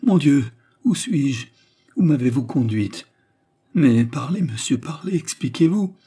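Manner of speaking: high, loud frantic questions; alarmed cry. A narrator's reading. Woman waking in a strange house, alarmed. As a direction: plead for answers, pitch climbing. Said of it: "Elle le regarda et jeta un cri".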